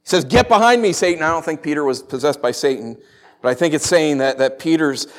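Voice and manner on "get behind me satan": loud, higher opening rebuke; yelling back. Acted. Jesus yelling back at Peter, briefly loud. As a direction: fire back sharply, falling off at the end. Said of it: "Yells back".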